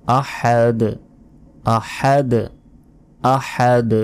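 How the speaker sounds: আহাদ with a breathy throat h; clear ha sound. Shown as a demonstration. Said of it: "হার উচ্চারণ হবে".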